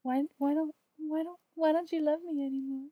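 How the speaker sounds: whiny pleading, hammed-up hurt; mock heartbroken whine. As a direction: plead like a jilted lover, half joking. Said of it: "My broke little heart was hurting".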